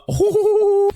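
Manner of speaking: a real laugh squeaking up high; squeaky high laugh. Real, not acted. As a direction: burst of squeaky giggling, way up high. Laughs at own rhyme.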